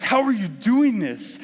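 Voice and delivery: slow retold outburst of frustration; frustrated. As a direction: imitate a baffled, frustrated friend. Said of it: "legitimately frustrated".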